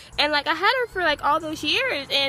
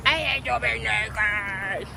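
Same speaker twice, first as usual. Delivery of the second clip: voicing the dog; 4 semitones lower, 3 dB quieter, slower than usual.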